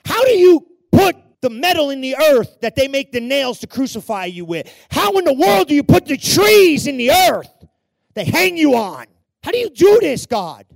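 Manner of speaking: loud, high incredulous questions; impassioned marvelling. Real, not acted. Preacher asks God how he could do this, crying out in amazement. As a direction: cry out each question, dropping between bursts.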